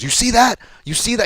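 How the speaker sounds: high-pitched taunting call, retold; imitated heckling. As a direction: mimic a cornerman goading the other side. Retold corner heckling the other fighter.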